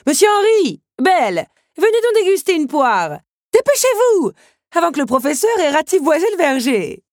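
high, loud call through the trees. Acted. Countess calling Henri and Belle to the pears, high and loud. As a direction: summon them from a distance.

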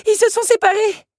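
high, loud frightened shout; alarmed cry. Acted. Belle realizes the lions split up, alarmed cry. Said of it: "saisit Belle".

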